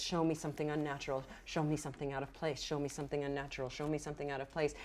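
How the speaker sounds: soft, fast chanted plea; hushed incantation. Acted. GM voicing the elf's plea to the earth, soft fast chant. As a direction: murmur it like a repeating spell. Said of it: "the vibe that you're giving it is".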